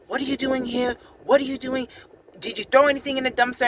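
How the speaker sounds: high, harsh mock yell; imitating a furious stranger; irate guard. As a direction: bark it like an angry security guy. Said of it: "he was just irate".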